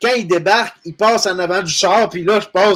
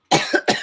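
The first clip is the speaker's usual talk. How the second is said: harsh choking cough; coughing fit. Real, not acted. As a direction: cough it up hard. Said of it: "j'ai avalé une mouche noire".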